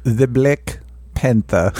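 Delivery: slow, drawn-out solemn movie title; Forrest Whitaker impression. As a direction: say it slowly like a grave narrator.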